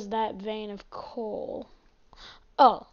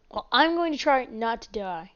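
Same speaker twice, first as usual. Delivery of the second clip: ordinary talk, nothing marked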